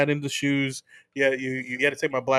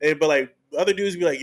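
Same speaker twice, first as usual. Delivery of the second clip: normal talking voice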